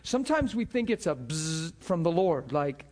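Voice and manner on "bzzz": quick buzzing zap; imitated zap. Preacher mimicking a jolt from the Lord, buzzing. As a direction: buzz like a jolt of electricity.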